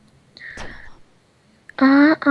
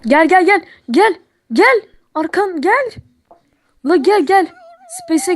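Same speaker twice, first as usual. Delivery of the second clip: much higher urgent calling; 8 semitones higher than usual. Real, not acted. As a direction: call him over eagerly. Calling friend over urgently.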